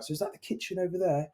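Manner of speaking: a hint of a frail old woman peering around; elderly lady. Builder retelling the partially blind neighbour touring the house, lightly voiced old lady.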